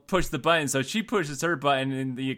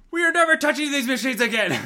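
fed-up vow; 10 semitones higher, 4 dB louder, faster than usual. Retold or imitated. Fed-up mom after machine eats quarters.

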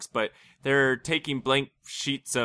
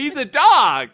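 high, loud exclamation; incredulous outburst; 12 dB louder than usual. Real, not acted. Friend loudly protesting that it's a dog, high-pitched. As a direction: throw up your hands, it's a dog. Laughing about dog missing catch.

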